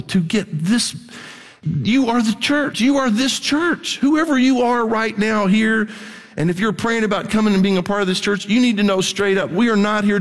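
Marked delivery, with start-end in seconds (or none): 1.6-5.9 slow, rising pulpit declaration; slow, lifted preacher proclamation; slow preaching emphasis. Real, not acted. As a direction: proclaim each word slowly.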